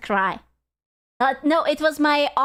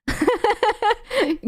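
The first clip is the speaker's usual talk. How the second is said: a sudden high burst of laughter; embarrassed giggle at own slip; 10 semitones higher than usual. Real, not acted.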